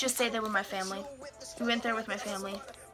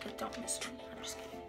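whispered aside; 14 dB quieter, faster than usual. Real, not acted.

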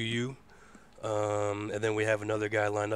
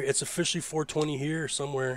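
ordinary delivery

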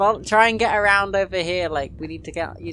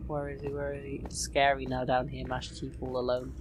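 hushed nervous; 6 semitones lower, 10 dB quieter than usual. Real, not acted. Hiding alone in dark corridor.

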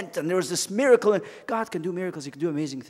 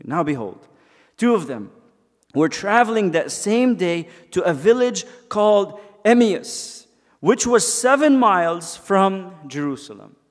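slow, raised scripture reading; 3 semitones higher, 7 dB louder than usual. Acted. Pastor reading Luke to the church, slow and lifted. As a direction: read the verse out slowly.

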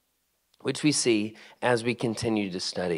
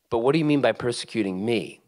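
ordinary talk

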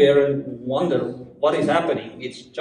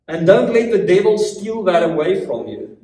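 ordinary delivery

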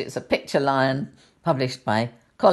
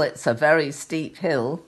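plain reading voice

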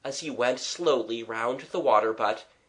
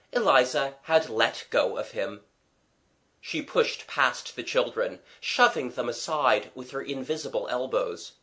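usual speaking voice

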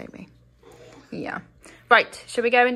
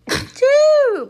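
high, loud real sneeze; 9 dB louder than usual. Real, not acted. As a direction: let out a big sneeze. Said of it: "I'm gonna sneeze".

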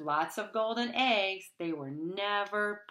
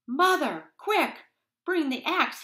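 high, loud urgent shout; 9 semitones higher, 7 dB louder than usual. A narrator's reading. Jack shouts to his mother for the axe. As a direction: yell for the axe. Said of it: "he shouted".

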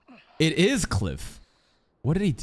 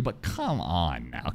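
exasperated; 11 semitones lower, 6 dB quieter, much slower than usual. Real, not acted. Exasperated plea to viewers.

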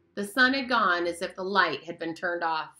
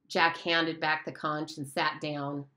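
plain reading voice

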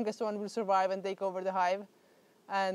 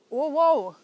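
real surprised whoa, second one louder and higher; startled whoa; 4 semitones higher, 7 dB louder than usual. Real, not acted. Beekeeper suddenly spots the queen bee, startled. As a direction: blurt it out, jumping in volume.

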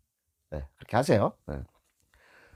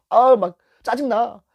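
higher, louder slow grumble; hammed-up frustrated driver; mock annoyance; 10 semitones higher, 5 dB louder, slower than usual. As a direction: act out the rookie's irritation. Rookie driver's frustration voiced.

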